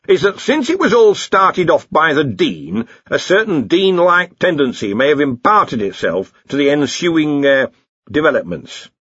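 phrase it delicately with a hesitation; theorising lecturer. Acted. Lecturer delicately blames the Dean's influence, donnish and halting.

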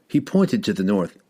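usual speaking voice

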